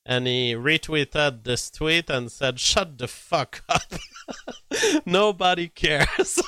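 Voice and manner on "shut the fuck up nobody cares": can't hold it together, laughing at the line; real amused laughter. Real, not acted. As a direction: quote it, then break into high laughter. Laughs while quoting the retweet.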